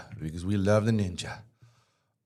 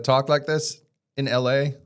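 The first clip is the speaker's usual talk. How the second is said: mocking LA twang, pitched a bit higher; LA valley speak; 4 semitones higher, 4 dB louder than usual. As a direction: poke fun at how people in LA talk. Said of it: "I'm talking like this".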